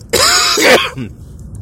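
harsh hacking cough; chesty cough. Real, not acted. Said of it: "minchia che tosse".

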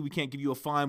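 normal talking voice

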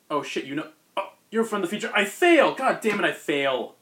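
hammed-up villain realizing his doom; mock panic. Otto realizes his future failure.

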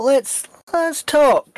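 drawn-out menace. Acted. Interrogator turns menacing.

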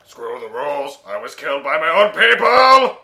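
loud anguished cry; dramatic shout. Pretend. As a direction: yell it like an anime villain. Quoting anime line loudly.

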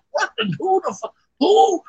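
high, sputtering disbelief; retold baffled squeak; shocked, off guard. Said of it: "off guard and shocked".

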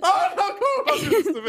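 high laughing "oh no"; laughing protest. Real, not acted. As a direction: groan through laughter at the pick. Friend picks a long movie.

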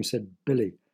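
ordinary delivery, nothing marked

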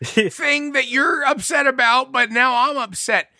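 overwhelmed dad losing it; exasperated parent. Retold or imitated. Dad overwhelmed by daughter's crying, high exasperated whine.

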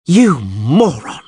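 slow, loud furious yell; angry yell. A narrator's reading. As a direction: spit it at him, enraged. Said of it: "Yennefer yelled".

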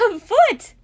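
very high, loud squeal of delight; happy squeal. A narrator's reading. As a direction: squeal the word happily. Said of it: "Luna squealed happily".

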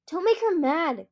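plain reading voice

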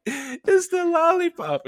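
high, loud, drawn-out squeal; mock hysterical realization, very high; helpless laughing, reenacted. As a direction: wail it as if cracking up. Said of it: "I was laughing really bad".

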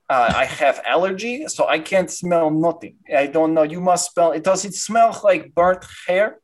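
put-on heavy Russian-accented doctor; thick Eastern European accent, slightly congested. Doctor sniffing for burnt hair, in a Russian accent. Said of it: "very Russian man".

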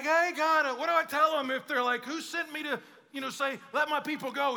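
high, loud, hesitant questioning; mock-panicked appeal; freaking-out Moses. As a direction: act a flustered Moses pleading for instructions. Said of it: "when Moses was freaking out".

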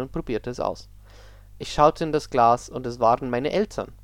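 spooky story read aloud; story narration. Acted. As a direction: narrate a creepy tale, steady.

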